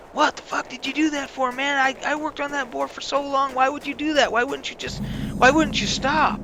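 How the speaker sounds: high, angry retold rant; angry outburst retold. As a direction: replay the furious complaint at the duelist. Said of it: "I was pissed".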